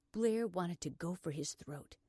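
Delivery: plain reading voice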